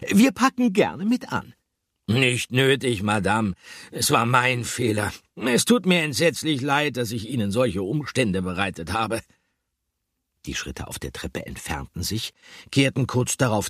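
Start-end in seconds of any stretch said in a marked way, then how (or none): 0.1-1.5 much higher and louder, kindly offering; a helpful lady calling down the stairs; friendly shopkeeper woman. A narrator's reading. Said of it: "antwortete die Stimme von Frau Fröhlich". 2.1-9.2 apologetic man. A narrator's reading. Albert apologizes to Madame.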